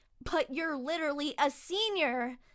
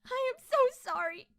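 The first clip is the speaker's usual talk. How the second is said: tearful; 9 semitones higher, 6 dB louder than usual. Acted. Apologizing after smearing sauce on him.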